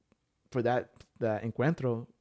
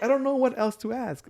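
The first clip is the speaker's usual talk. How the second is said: much higher, loud pleading protest; retold exasperated yell; 11 semitones higher, 5 dB louder than usual. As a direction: plead it loudly, acting out your exasperation. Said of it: "you're, like, yelling at the pastor".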